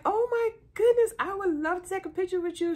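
high, loud, fast fan gush; high squealing excitement; excited gush. Retold or imitated. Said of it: "I was like".

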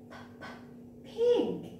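bare puffed p, then the whole word "pig"; isolated p sound. Shown as a demonstration. Teacher showing children the first sound, isolated p. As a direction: sound out the p before saying "pig". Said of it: "all of these has p sound".

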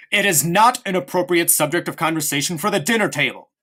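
stern repeat that cuts him off; a hard, final warning; sharply. A narrator's reading. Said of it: "repeated Father sharply".

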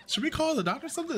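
high, rushed imitation of an onlooker; concerned bystander. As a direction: mimic a fussing stranger, fast and high.

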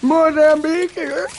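voiced game callout, falling end; character callout voice. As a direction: imitate the in-game callout line. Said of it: "All I'm doing is my normal voices".

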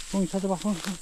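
high coaxing pet voice; talking to a dog. Real, not acted. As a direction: coax the dog to find it. Training dog to find mushrooms.

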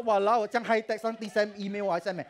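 high and loud mock gushing; mocking naive belief. Telling the audience not to think God texts, loud mocking voice. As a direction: make fun of the idea that God sends email, loud and high. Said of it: "你不要以为说".

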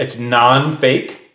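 non-fake drawn out slowly and dryly; slow sardonic. Real, not acted. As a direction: say it slowly like it's absurd. Mocking the word nonfiction.

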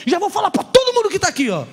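high, loud, laughing call to everyone; loud excited proclamation; bold announcement. Pretend. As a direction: address the whole metro car.

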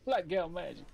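mock sassy voice. Repeating a funny game line.

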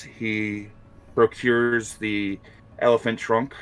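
ordinary delivery

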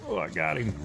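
ordinary delivery

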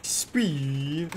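ordinary talk

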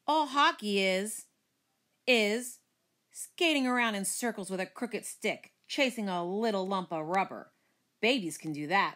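usual speaking voice

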